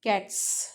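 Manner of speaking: cats with a sharp unvoiced ts ending; cats, no vocal cord buzz on the s; voiceless plural s. Shown as a demonstration. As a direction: end cats on a pure hiss. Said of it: "is pronounced as voiceless".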